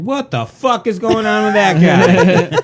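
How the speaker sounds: high, loud, drawn-out outburst; hammed-up horse in loud disbelief; freaked-out horse. Horse watching someone asleep, freaked out. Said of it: "most likely a horse being like".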